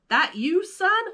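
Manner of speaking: high calling Goofy voice, rising up on son. A narrator's reading. Goofy calling to his son from the kitchen, cheerfully. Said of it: "Goofy called".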